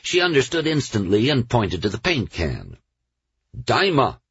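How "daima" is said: put-on firm, higher stern little girl. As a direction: say it with great firmness. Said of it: "she said it sternly and with great firmness".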